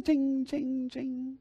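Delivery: high, ringing monotone coin chimes; imitated coin clinks. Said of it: "they went ting".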